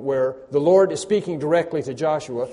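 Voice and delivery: normal talking voice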